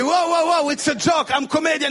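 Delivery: high, loud, hurried pleading; panicked protest. Acted. Comic pleading with the airport guard, panicked and loud.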